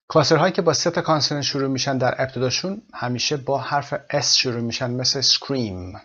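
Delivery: normal talking voice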